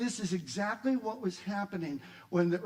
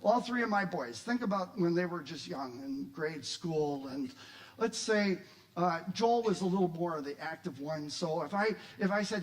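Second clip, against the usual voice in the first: usual speaking voice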